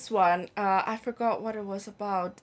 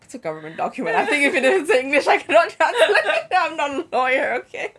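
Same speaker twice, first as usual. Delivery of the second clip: laughing retort; 5 semitones higher, 7 dB louder than usual. Retold or imitated.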